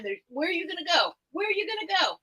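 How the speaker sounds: high coaxing pet voice; sweet-talking the cat. Real, not acted.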